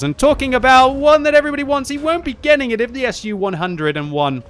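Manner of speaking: high, loud hype call; excited shout. Real, not acted. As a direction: ramp up the hype. Hyped caster build-up.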